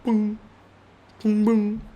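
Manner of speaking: mime sound effects. Acted.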